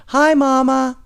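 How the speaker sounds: put-on high, loud cheerful child greeting; snowy boy greeting his mother; little boy voice. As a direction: pop up and greet your mom. Little boy pops out of snow.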